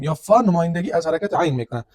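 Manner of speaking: normal talking voice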